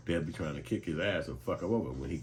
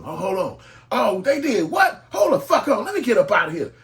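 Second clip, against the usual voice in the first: much higher and louder, laughing disbelief; shocked outburst; 15 dB louder than usual. Retold or imitated. Worker hearing wild news on phone, loud shocked laughing outburst. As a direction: leap up shouting in disbelief.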